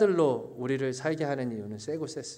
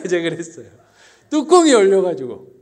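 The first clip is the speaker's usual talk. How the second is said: ordinary talk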